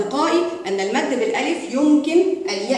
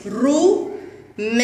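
very slow with the long vowel drawn out; stretched out for spelling; much slower than usual. Shown as a demonstration. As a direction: stretch رومان out for the class. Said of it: "نتهجأ". (نطق رمان ببطء مع مد الألف)

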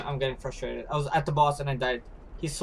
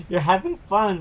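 normal talking voice

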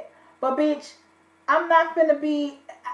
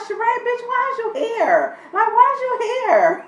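high-pitched mock outrage at Sheree; exasperated disbelief; 10 semitones higher than usual. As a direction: ask it like she can't believe her eyes. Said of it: "I'm like".

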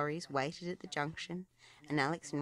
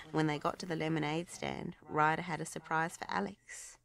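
ordinary delivery, nothing marked

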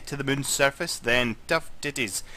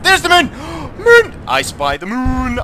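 high, loud excited shout at the moon; 12 semitones higher, 9 dB louder than usual. Real, not acted. Spots the moon in game.

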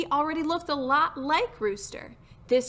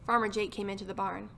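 ordinary delivery, nothing marked

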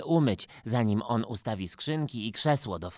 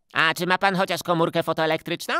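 higher, louder cheeky call; 5 semitones higher, 6 dB louder than usual. Acted. Gottfried calls out to the photographer, higher and louder. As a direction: pester him with a loud question. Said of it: "zawołał Gottfried".

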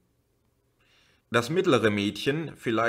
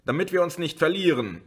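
raised, higher call; 8 semitones higher, faster than usual. A narrator's reading. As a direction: keep calling so nobody gets lost. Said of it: "rief ihr die Frau zu". (höher zugerufen)

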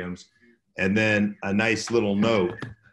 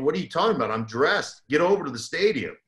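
higher, urgent mock exasperation; urgent retold reply; 6 semitones higher, 3 dB louder, faster than usual. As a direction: reenact telling him to hurry up, higher.

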